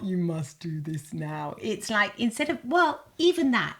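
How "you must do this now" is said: low, slow command voice; calm deep command; soothing command. Shown as a demonstration. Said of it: "It's not".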